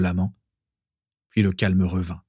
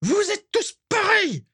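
very high, slow, drawn-out furious roar; roaring fury. Acted. Walburga roaring at the painter and Vera, slow high fury. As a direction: roar it out in contempt. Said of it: "rugit Walburga".

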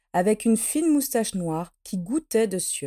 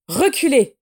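loud, higher command; forceful warning, voice raised; ordering; 4 semitones higher, 6 dB louder than usual. A narrator's reading. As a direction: order them back sharply. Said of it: "ordonna Narcissa".

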